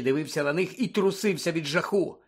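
ordinary delivery, nothing marked

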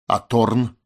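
short question put with some edge; sharp question. A narrator's reading. Loy demanding news of Torn from her companion, sharp. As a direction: ask it abruptly. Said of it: "резко спросила Лой".

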